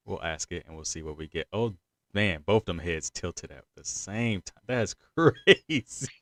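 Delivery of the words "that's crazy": slow words broken by a laugh; laughing disbelief. Real, not acted. Host laughing at guests tilting heads together, amused. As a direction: chuckle it out, amused. Both heads tilted at once.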